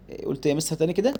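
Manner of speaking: usual speaking voice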